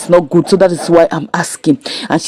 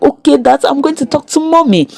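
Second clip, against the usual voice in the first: put-on higher-pitched, agreeable child's voice; imitated daughter; 7 semitones higher than usual. Daughter promises Dad she will talk to Mommy, in a higher voice. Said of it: "the daughter was like".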